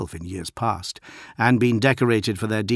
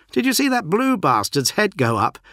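put-on raised, eager young marine; a brash young recruit, pitched up; young warrior voice; 11 semitones higher, 5 dB louder than usual. A narrator's reading. Berias voiced by narrator.

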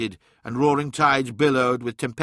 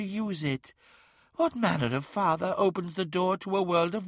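high, persuasive otherworldly voice; insinuating daemon tempter; 6 semitones higher than usual. A narrator's reading. Eyes turn Magnus against his father, high and insinuating.

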